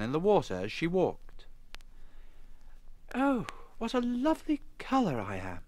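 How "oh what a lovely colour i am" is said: high-pitched, musing to herself; self-admiring. A narrator's reading. Emma admires her reflection by the river, self-admiring. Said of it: "she said to herself".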